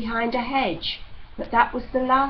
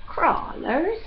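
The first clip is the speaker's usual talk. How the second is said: puzzled echo; 8 semitones higher than usual. Acted. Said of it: "echoed Demelza".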